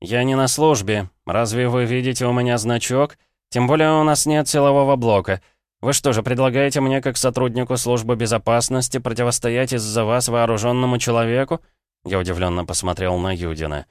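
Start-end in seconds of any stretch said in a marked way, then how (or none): 0.0-11.6 higher-pitched, emphatic rebuff; sarcastic security officer. Acted. Officer refuses to protect the minister, sarcastic and emphatic. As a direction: push back with mocking, raised-pitch questions.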